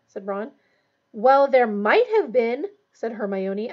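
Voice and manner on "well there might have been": slow, drawn-out, louder admission; grudging concession. Acted. Hermione answering Ron about the firebolt, grudging concession. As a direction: concede it slowly, stressing the doubt. Reluctant concession in acted dialogue.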